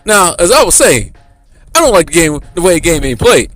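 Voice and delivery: Jordan ranting. Pretend. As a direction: go on about the good old days, fed up. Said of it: "Him just going on a tangent".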